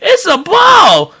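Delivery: drawn-out, very high shout; excited shout. Acted. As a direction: yell it high and stretch it out. Acts out finding the ball.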